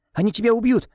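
high frightened cry; desperate cry. A narrator's reading. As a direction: plead in fear for him. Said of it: "в отчаянии выкрикнула Нонна". (отчаянный испуганный крик)

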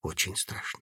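barely audible confession; very quietly. A narrator's reading. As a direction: say it almost silently. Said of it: "Добавил совсем тихо".

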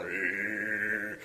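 low, soft rabid growl. Retold or imitated. Podcast host mimics a rabid infected person, low growl. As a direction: growl like a foaming rabid animal. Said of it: "I'm foaming at the mouth going".

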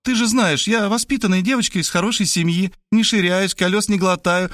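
put-on high girlish voice, protesting her virtue; self-righteous young woman. A narrator's reading. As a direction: insist you're a good girl, pitched high. (высокий девичий голос, убеждает с достоинством)

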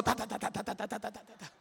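rapid-fire soft "ta-ta-ta", unvoiced, dying away; imitated punch flurry. Boxer retelling how his opponent began the blows, imitated punch flurry. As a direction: imitate a volley of punches in quiet percussive syllables.

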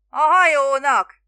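slow, high, very loud shout. A narrator's reading. Spiro explaining to the fisherman, shouting. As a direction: stretch the word out in an exasperated yell. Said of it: "kiabált Spiro".

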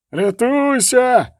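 high, drawn-out yell; long high-pitched cry of alarm; screamed warning. A narrator's reading. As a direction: scream it in panic while galloping past. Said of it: "зарепетував, галопуючи мимо".

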